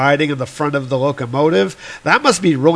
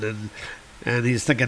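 ordinary delivery

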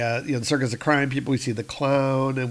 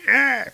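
pained cry; 12 semitones higher, 4 dB louder than usual. Retold or imitated. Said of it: "Ulik is kind of going".

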